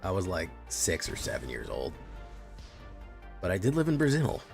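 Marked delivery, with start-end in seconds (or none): none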